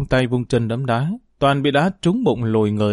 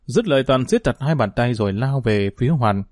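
ordinary delivery

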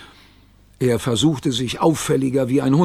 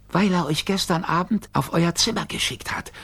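higher, small creature voice; tiny creature voice; 5 semitones higher, 4 dB quieter than usual. A narrator's reading. Gigglerin explaining to the boys, small high voice.

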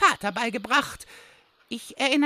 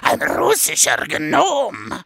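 Russian gnome father voice; 8 semitones lower, 5 dB louder than usual. Acted. Goblin switching to his Russian gnome father's low loud voice. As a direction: lower and louder gruff gnome father. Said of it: "mit zwei unterschiedlichen Stimmen sprichst".

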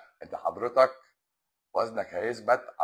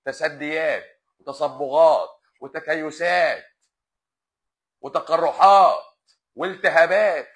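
each word dragged out slowly and hammered louder; emphatic list; 3 semitones higher, 9 dB louder, slower than usual. Real, not acted. Doctor hammering the findings home to a viewer, slow and loud. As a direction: spell out every finding, slow and heavy.

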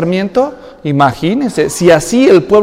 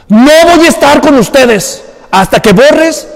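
slow, raised, weighty warning; God's stern warning; 5 semitones higher, 4 dB louder than usual. Retold or imitated. God warning Joshua about the sin, slow stern voice. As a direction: lay down the condition slowly. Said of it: "le dice".

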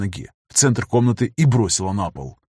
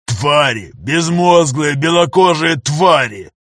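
loud, slow spat insult; 4 semitones higher, 5 dB louder, slower than usual. Acted. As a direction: spit the words out in disgust. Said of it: "сказал, словно выплюнул".

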